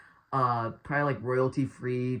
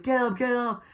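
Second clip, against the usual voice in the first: high-pitched imitated yell at ordinary volume; mock monotone yell; 10 semitones higher than usual. Guy trying to rouse crash survivor, mock monotone yell. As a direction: mimic a badly acted movie yell. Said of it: "the most monotone voice ever".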